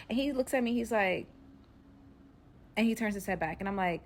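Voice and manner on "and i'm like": ordinary talk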